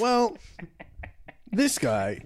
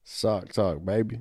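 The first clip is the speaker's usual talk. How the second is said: low, soft rapper impression; 9 semitones lower, 6 dB quieter, slower than usual. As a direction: drop it way down, quietly. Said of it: "Does that sound like him?".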